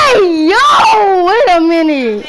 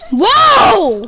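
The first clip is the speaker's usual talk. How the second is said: surprised shout; 8 semitones higher, 5 dB louder than usual. Real, not acted. Cracking up at video.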